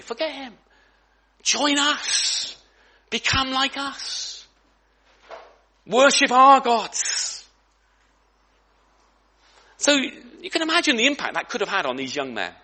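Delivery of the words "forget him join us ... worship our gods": put-on Babylonian persuader. Voicing Babylonian demands.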